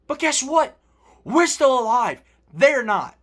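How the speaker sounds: triumphant yelling rant about still being alive; heated rant. Real, not acted. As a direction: gloat at the haters at full volume. Escalating rant at rival fans.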